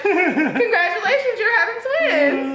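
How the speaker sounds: high, laughing, slowed congratulations; laughing announcement. Retold or imitated. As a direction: announce the twins grinning, pitched high. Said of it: "And she's like".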